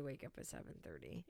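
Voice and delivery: quick hushed whisper. Acted. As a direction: whisper it fast, under your breath. Repeats the line in whisper.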